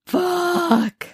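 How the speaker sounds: high, drawn-out curse; mock dismay. Kip caught out over his affair, mock dismayed curse. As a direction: stretch the word out, pitched high, imitating dismay.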